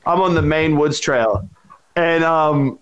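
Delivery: usual speaking voice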